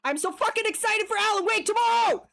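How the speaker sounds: high, loud excited yell; real excitement about Alan Wake; excited shout. Real, not acted. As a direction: burst out with real hype. Hyped about a game release.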